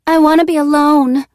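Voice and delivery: high, loud cry with a cracking voice. Acted. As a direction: let the voice crack as you cry it out. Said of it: "Nola cries".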